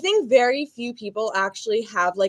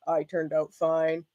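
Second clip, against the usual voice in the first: slow, low-key, sceptical echo; self-mocking; 3 semitones lower, 5 dB quieter, slower than usual. Mocking their own claim after calling it a lie, self-mocking. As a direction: repeat it slowly, softer, disbelieving yourself.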